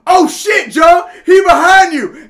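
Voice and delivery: yelling at screen. Retold or imitated. Moviegoer yelling a warning to the hero, full shout. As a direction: holler at the movie screen like a hyped viewer. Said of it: "they're just, like, yelling out".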